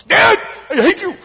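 high, loud, mock teenage wail; daughter's outburst. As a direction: whine like a woken-up kid. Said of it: "She's trying to go back to sleep".

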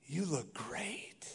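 breathy hushed "You look great"; whispered compliment. Shown as a demonstration. Pastor demonstrating a hushed compliment to a wife, whispered. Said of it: "Same words, different meaning".

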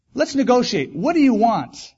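a hammed-up talking bear making an offer. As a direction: sell the deal like a friendly bear. Bear voiced in joke.

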